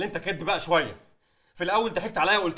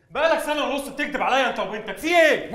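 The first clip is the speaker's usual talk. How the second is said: angry confrontation; 4 semitones higher, 4 dB louder than usual. Acted. Son accuses his father of lying for years, angrily shouting. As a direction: voice climbing higher and louder into accusation.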